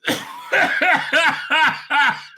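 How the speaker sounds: high, helpless cackle; real laughter. Real, not acted. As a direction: break into a long high laugh. Laughing at own joke.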